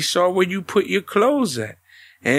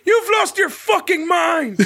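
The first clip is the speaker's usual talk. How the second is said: mock yell; 11 semitones higher than usual. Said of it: "He's like".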